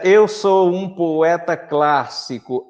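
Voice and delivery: "Eu sou um poeta clássico" spoken slowly, syllable by syllable; slow syllable count. Shown as a demonstration. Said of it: "aqui eu já vou ter nove sílabas".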